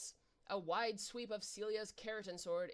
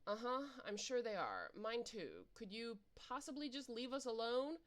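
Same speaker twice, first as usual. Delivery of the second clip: usual speaking voice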